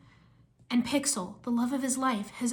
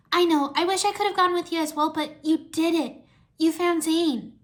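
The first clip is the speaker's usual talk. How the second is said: high, eager character voice; excited young woman; 5 semitones higher, 5 dB louder than usual. A narrator's reading. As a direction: lift the pitch and praise a friend. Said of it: "Irene said".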